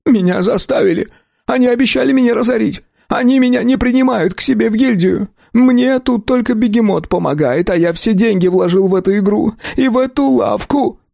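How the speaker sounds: high, whimpering, on the edge of tears; tearful pleading. A narrator's reading. Shopkeeper pleading tearfully, high and whimpering. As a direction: whine it out almost sobbing. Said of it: "Ларус заплакал просто навзрыд".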